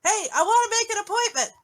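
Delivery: loud, high calls into nobody there; exasperated caller. Acted. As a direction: call out impatiently for an answer. Acts out exasperated caller getting no answer.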